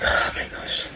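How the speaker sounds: imitating a private, whispered reaction; whispered dismay. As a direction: whisper it to yourself, very softly. Shane alone at the sink.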